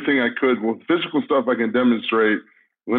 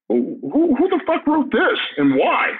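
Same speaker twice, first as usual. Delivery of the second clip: much higher, slow, hammed-up angry demand; mock furious rant; 14 semitones higher than usual. Wrestler imitating his own anger at the promo, hammed-up. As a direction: play up the outrage at four pages. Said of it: "I was like".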